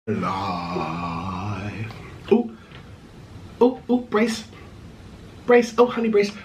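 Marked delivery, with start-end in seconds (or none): none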